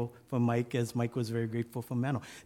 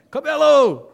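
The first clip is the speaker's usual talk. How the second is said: very high, very loud name call; sergeant shouting; 13 semitones higher, 13 dB louder, slower than usual. Retold or imitated. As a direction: bellow the name down the line. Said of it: "clothing sergeant calls out again".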